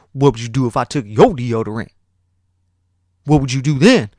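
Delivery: imitating a bully's taunt, voice pushed up; put-on taunting question; mock challenge. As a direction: throw down a dare, rising in pitch and volume.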